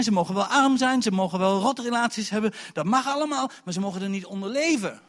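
loud, high, insistent preacher delivery; full-force preaching. Real, not acted. Preacher urging the congregation, full-force preaching. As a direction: preach it at the top of your voice.

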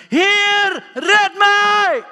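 high, full-force cry for rescue; desperate shout. Acted. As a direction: scream it out as if sinking. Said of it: "schreeuwde het uit". (wanhopig uitschreeuwen)

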